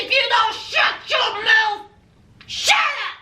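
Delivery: high sharp shout; mother yelling at her son in the car; mama yelling. Retold or imitated. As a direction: shout him down to shut up.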